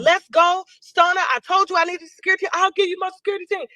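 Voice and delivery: calling across to him in a hurry. Retold or imitated. Retelling her own call to Stunner.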